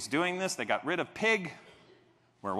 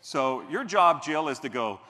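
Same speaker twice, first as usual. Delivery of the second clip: usual speaking voice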